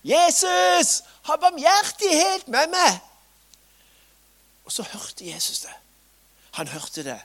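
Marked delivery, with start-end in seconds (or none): 0.0-3.0 high, loud pleading cry, louder still; even louder shout. Acted. Beggar shouting over those hushing him, even louder. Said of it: "bare enda høyere". 4.6-5.7 whisper. A narrator's reading. Tender turning point in story.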